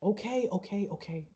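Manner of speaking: soft okays, fading away; placating. Acted. Eric gives in to clinging sister, placating softly. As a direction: give in quietly.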